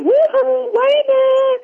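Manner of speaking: very high sing-song woo-hoo; calling out. Retold or imitated. Bernard hailing Wayman at work, very high sing-song call. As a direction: call his name across the room, high and playful.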